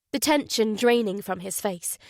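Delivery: usual speaking voice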